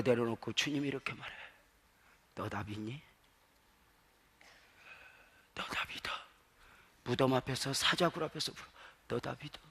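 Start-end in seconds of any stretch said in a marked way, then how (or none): 2.3-6.1 very soft, close question; hushed probing question. Acted. 밑바닥에서 예수님이 묻는 장면. 9.1-9.6 very soft challenge of faith; hushed probing question. Acted. 사자굴 앞에서 묻는 예수님.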